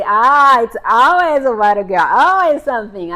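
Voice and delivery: loud, animated "aha" reaction; big, teasing outburst; knowing exclamation. Retold or imitated. Teasing reaction to it's a girl.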